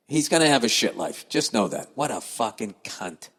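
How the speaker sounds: normal talking voice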